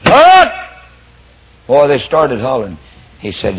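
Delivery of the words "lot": loud, high hammed-up yell from a bass voice; mock bass voice yelling. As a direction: play a big man bellowing from outside. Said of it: "Old bass voice".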